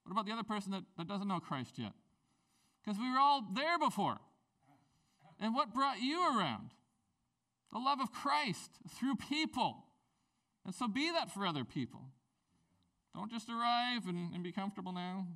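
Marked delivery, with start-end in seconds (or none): 2.8-12.0 higher, louder preacher's cry; preaching at full force. Acted. Pastor pressing the congregation to love others, full preaching force. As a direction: drive it home to the congregation.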